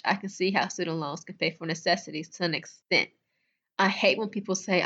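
usual speaking voice

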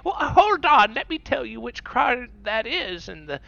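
high, eager, oblivious voice; naive old lady. Acted. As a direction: chirp it like a trusting grandma. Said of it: "someone that'll be like".

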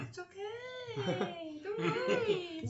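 very high, drawn-out polite falsetto; exaggerated high-pitched service reassurance; upbeat customer-service voice. Customer-service worker reassuring apologetic callers, exaggerated high upbeat voice. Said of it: "upbeat sounding person".

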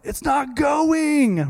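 high, slow, drawn-out whine; mocking frustrated victim. Preacher imitating the prank victim for the church, whiny and slow. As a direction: mimic the man stuck with shampoo. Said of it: "what's the guy mad about".

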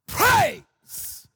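high, loud cry after a stammer; triumphant burst. Retold or imitated. Stuttering evangelist says what came out of him, triumphant cry. Punchline of the stutterer's retold reply.

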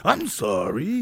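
put-on impression voice, slightly drawn out; impression of a comedian. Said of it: "That was me doing Adam Ray".

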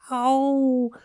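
very high, drawn-out little cry; small creature's high, thin wail; tiny ladybird voice. A narrator's reading. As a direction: squeak it out in a tiny voice. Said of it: "said the ladybird".

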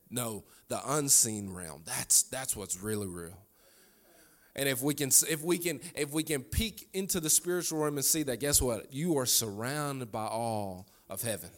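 normal talking voice

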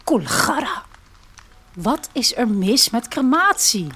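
a hint of a calm, idealistic young man; unruffled young man. A narrator's reading. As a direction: carry on as if nothing happened. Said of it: "gaat Olivier onverstoorbaar verder".

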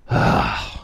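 heavy, breath-only sigh; exasperated sigh. A narrator's reading. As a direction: let out a fed-up sigh.

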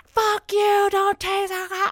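high, drawn-out imitation of a furious man; mock pissed-off yell. Pissed guy defying a cop with a taser, mock furious. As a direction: wail it slowly as an enraged detainee. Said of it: "the guy is just super fucking pissed".

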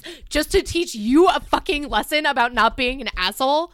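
loud, indignant tirade; really upset. Real, not acted. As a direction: angrily hammer out the challenge. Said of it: "I'm getting really upset".

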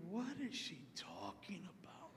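whispered inner thought; puzzled whisper. Retold or imitated. Weary man thinking about chatty stranger, baffled whisper.

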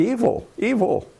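Evil, evil. lift both words high, the first rising and the second falling; emphatic repetition. Real, not acted. Preaching to listeners about evil in France, with both evils lifted high.

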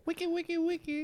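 very high, soft scratch noise. Acted. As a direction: mimic a record scratch up high. DJ scratch jingle.

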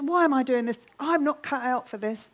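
ordinary delivery

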